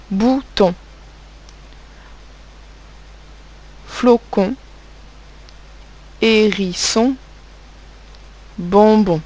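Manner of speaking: each word spoken alone with long pauses; slow dictation. Shown as a demonstration. Teacher dictating words to pupils, slow and clear. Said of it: "dictating words to pupils".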